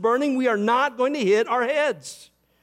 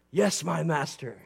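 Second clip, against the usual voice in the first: devoted disciple; 5 semitones lower, 4 dB quieter than usual. Retold or imitated. Disciple addressing his teacher.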